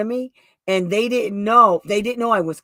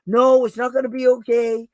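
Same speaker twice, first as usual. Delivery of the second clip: higher, louder retold objection; mock parental protest; 5 semitones higher, 5 dB louder than usual. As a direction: imitate parents refusing to be reassured. Parents rejecting her reassurance, acted.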